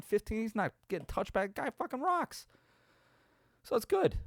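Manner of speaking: giddy high-pitched praise for the punter; excited rave. Real, not acted. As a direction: rave about him, voice jumping way up. Raving about the punter's great punts.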